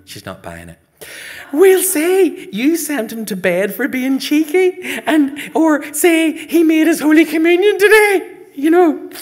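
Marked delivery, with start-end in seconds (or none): 1.5-9.1 put-on very high, loud child's gabble of glee; excited scheming. Son selling ma a cover story, excited. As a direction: pitch the cover story at full tilt. Boy eagerly sells his story.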